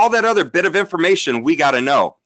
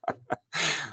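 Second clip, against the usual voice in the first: sudden laugh; 2 semitones higher, 13 dB quieter than usual. Real, not acted. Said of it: "I'm laughing".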